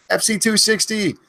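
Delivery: high yelled name; screamed name. Acted. Streamer yelling a viewer's name, high yell. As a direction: call it out as a big yell. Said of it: "I end up screaming too much".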